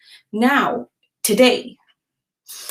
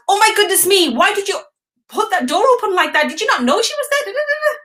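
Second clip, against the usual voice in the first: high, fast, exasperated mock scolding; mock yelling rant; 10 semitones higher, faster than usual. Mother scolding daughter over the door, mock angry. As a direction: rattle it off like a frazzled mum. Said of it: "What I wanted to say was".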